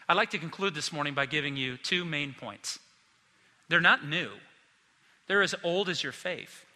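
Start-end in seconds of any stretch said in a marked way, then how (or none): none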